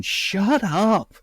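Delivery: high, hissed mock "Shut up." with a falling "up"; playful mock rebuke "Shut up."; mock scolding whisper-shout. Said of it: "grabbing him by the neck going".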